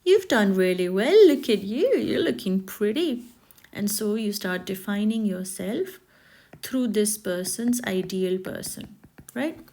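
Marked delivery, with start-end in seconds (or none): 0.0-3.2 gushing praise. Retold or imitated. Person who pushed the weight issue praising her, gushing loudly. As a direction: cheer her on like an impressed friend. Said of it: "now they're like".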